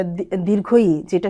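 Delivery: usual speaking voice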